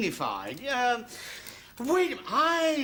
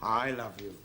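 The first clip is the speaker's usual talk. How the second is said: low "I love you", leaning on "I"; stress on I; 12 semitones lower than usual. Shown as a demonstration. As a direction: put the weight on "I", low-pitched. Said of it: "so much depends on the inflection".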